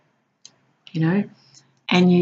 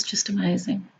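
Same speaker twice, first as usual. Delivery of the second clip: whisper; 7 dB quieter than usual. Real, not acted.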